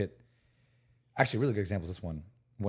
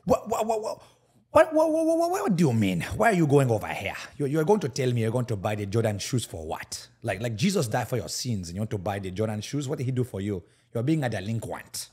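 put-on Nigerian accent; 3 dB louder than usual. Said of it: "I gotta, like, turn it on".

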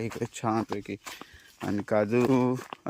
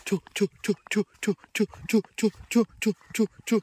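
high repeated "छू" sic-'em calls; egging on a dog; 10 semitones higher than usual. Real, not acted. Owner setting his dog on the cattle, high chu-chu calls. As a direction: urge the dog with quick high chu sounds.

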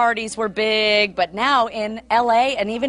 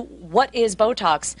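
ordinary talk, nothing marked

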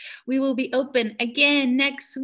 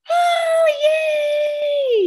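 high, loud cheer of joy; 8 dB louder than usual. Acted. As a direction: cheer the kids on, much higher and louder. Celebrating kids' success.

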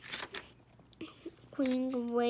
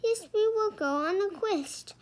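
high, insistent declaration; mild yell; 7 semitones higher than usual. A narrator's reading. Annie insisting on the quest, mildly yelling. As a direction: insist in a raised pitch. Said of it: "Annie yelled".

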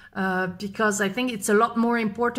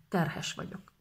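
whispered; 3 semitones lower, 10 dB quieter than usual. Shown as a demonstration. Whispered repeat for learners.

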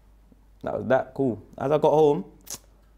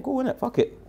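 much higher, shrugging it off; mock dismissive; 12 semitones higher than usual. Retelling his reply to the friend, careless mock shrug. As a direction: toss it away like it means nothing. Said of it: "But I'm saying".

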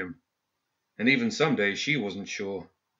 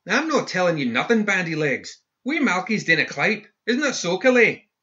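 put-on high-pitched Scottish street kid; defiant boy; 9 semitones higher, 3 dB louder than usual. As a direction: puff up like a cheeky kid. Boy voiced, Scots dialect.

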